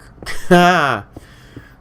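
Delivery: a loud burst of real laughter. Real, not acted. Laughing at own joke about having to work.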